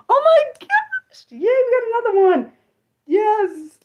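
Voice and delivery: high, loud squeal of delight; real excitement. Real, not acted. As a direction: celebrate it, voice shooting way up. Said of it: "I'm sorry, I get excited".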